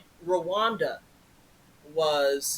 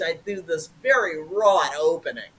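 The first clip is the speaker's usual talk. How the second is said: ordinary talk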